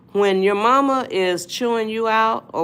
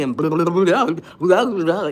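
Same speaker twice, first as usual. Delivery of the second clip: mock blustering; 4 dB louder than usual. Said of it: "you start blustering".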